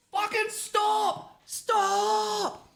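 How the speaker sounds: high, drawn-out panicked yell; imitated scream. Mum about to crash with her learner driver, screaming. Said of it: "to screaming".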